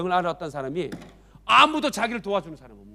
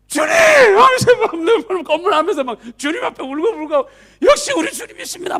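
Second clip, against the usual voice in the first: much higher, louder tearful cry; overjoyed cry; 11 semitones higher, 7 dB louder than usual. Acted. Sick man crying out to Jesus, high and loud. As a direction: cry out in relief.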